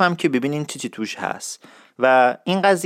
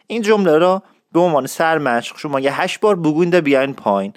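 ordinary talk, nothing marked

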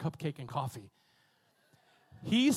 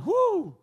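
high, loud whoop of fervor; preacher's woo; 12 semitones higher, 9 dB louder than usual. Real, not acted. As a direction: let out a sudden high woo. Preacher's exclamation at climax.

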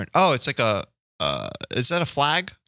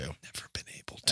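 whispering; 8 semitones lower, 14 dB quieter than usual. Acted. Echoed as a whisper.

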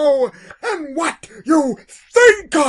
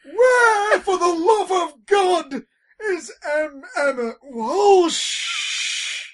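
high, hammed-up wail; mock anguished plea; 6 semitones higher, slower than usual. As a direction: beg the heavens in mock grief. Mock anguished fan cry.

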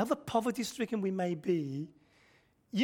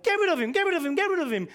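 repeated dismissive mock shout, higher; cold-hearted disciples; 8 semitones higher, 4 dB louder, much faster than usual. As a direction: wave them away again and again. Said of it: "These are serial cold hearters".

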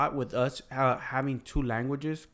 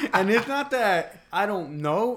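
laughing; 6 semitones higher, 6 dB louder than usual. Real, not acted. Laughing while explaining.

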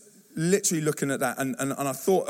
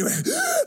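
high, exaggerated gulp of breath; big mock gasp. Imitating the boy diving into the pool, gasping. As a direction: suck in a huge shocked breath.

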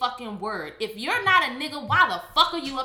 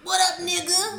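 greeting quote; 6 semitones higher, 4 dB louder than usual. Retold or imitated. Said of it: "whether it's the N-word".

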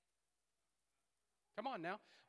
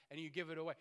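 ordinary delivery

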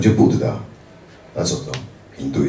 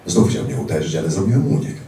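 ordinary talk

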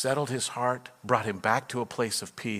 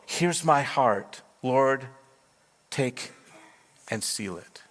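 tender quoted hymn; 3 semitones higher, slower than usual. Retold or imitated. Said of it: "She says".

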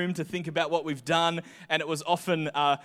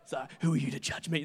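sulky defiance; 5 semitones lower, 7 dB quieter, faster than usual. Retold or imitated. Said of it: "That's what I was kind of feeling".

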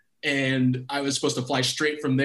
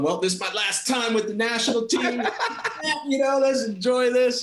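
laughing resignation; 7 semitones higher than usual. Retold or imitated. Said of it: "sitting there just going".

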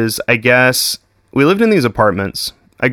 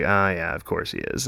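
mock humoring; 5 semitones lower, 11 dB quieter than usual. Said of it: "she's like".

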